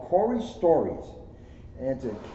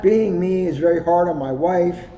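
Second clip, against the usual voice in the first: louder and higher, hammed-up emphasis; loud emphatic repeat; 6 semitones higher, 10 dB louder than usual. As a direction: punch it out for a laugh. Jokes about his wife.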